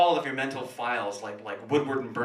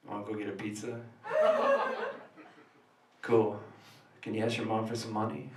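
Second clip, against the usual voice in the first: fake cool; 4 semitones lower, 4 dB quieter than usual. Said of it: "saying something super cool like".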